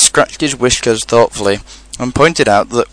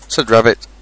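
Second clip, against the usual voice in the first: plain reading voice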